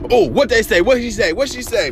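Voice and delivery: high gossipy busybody; gossipy mock voice.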